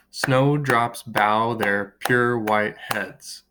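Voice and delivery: slow, measured count-along reading; syllable by syllable. Shown as a demonstration. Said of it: "How many syllables".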